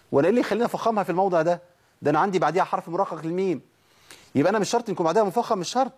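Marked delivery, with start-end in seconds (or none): none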